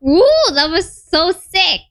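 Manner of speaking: usual speaking voice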